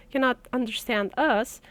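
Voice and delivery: ordinary talk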